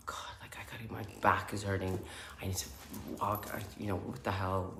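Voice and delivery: whispering restlessly during silent meditation; hushed grumble. Retold or imitated. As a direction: mutter it so nobody in the room hears. Restless thoughts in silent room.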